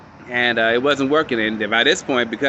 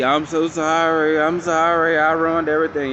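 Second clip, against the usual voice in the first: mock crying apology; 5 semitones higher than usual.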